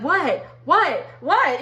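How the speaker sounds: repeated stunned what; shocked whats. Retold or imitated. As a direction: everyone gasping in disbelief. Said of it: "everybody's like".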